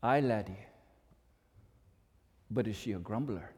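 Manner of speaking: a wise Scot probing his pupil; Scottish mentor. Acted. The mentor answering Lewis in a low, mild Scottish accent. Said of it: "his mentor".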